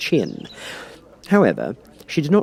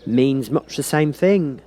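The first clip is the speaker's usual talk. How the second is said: high, slow, louder dismissive Duchess; 6 semitones higher, 4 dB louder, much slower than usual. A narrator's reading. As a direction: brush the point aside. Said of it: "said the Duchess".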